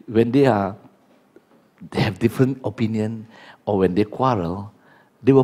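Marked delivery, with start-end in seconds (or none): none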